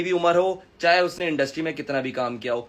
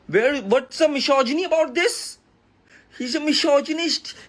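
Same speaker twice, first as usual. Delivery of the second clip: high, slow, hammed-up disbelief; mock outrage; 8 semitones higher, much slower than usual. Creator throws misogyny accusation back, high mock outrage. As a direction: draw it out in a put-on high voice.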